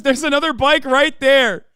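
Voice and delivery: quoting it loud, high and slow; emphatic retort. Retold or imitated. Streamer retells the teenager's comeback to viewers, emphatic retort.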